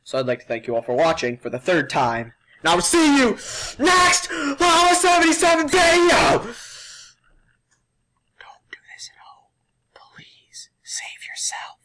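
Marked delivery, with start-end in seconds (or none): 2.6-6.4 high, loud sign-off shout; shouted outro. Real, not acted. Vlogger shouting goodbye to viewers, loud and high. 8.4-11.8 hushed, urgent whisper; whispered warning. Real, not acted. Vlogger warning viewers off the challenge, whispering.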